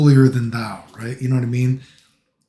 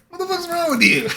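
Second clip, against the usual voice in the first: high-pitched mock disbelief; mock bystander. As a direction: imitate an appalled onlooker, pitched way up. Voices shocked bystanders reacting to him.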